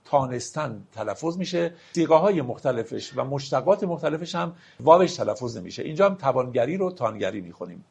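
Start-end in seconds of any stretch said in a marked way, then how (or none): none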